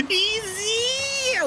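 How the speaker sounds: high, drawn-out self-imitation, kept soft; mock victory cry. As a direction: imitate your own squealed cheer, scaled down. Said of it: "Ich so". (hoch gezogener, nachgemachter Jubelruf)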